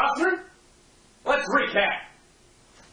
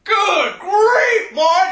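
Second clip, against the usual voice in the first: mock outrage; 4 semitones higher, 5 dB louder, much slower than usual.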